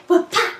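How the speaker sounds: loud, high "whoop-ah" sound effect; imitated action noise. Shoving the car aside, loud mock impact noise.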